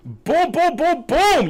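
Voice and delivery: very high, hooting boom chant; celebratory booms. Real, not acted. Streamer celebrating a perfect round, high excited booms.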